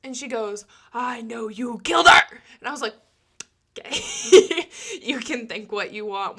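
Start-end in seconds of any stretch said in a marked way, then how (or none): 0.9-2.2 loud, flat accusation mimicking the caller; accusing caller. Caller accusing the new associate of murder, loud and certain. 3.8-6.4 breathy laugh on okay, then dry brush-off; laughing disbelief. Retold or imitated. Answering the caller who accused her, half laughing.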